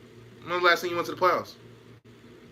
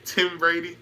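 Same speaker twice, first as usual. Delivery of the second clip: ordinary talk, nothing marked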